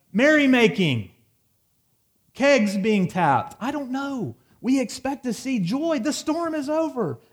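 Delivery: high and loud, caught up in the mock party; excited preaching. Preacher listing the party he expected, high and excited. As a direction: pile on the celebration images with rising excitement.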